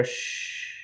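a soft breathy hush; quiet him like a sleeping baby; soft shush to calm the fallen friend; gentle shush. Acted. Finger to lips, hushing a friend.

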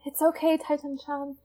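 soft, slightly high hushed comfort; whisper. Acted. Small girl reassuring her tall friend, whispering. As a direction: drop to a gentle near-whisper. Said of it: "And I whisper".

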